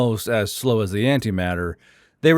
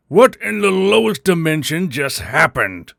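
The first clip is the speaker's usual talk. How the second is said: hollered demand; 4 semitones higher than usual. A narrator's reading. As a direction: bark the question in alarm. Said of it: "Grell hollered".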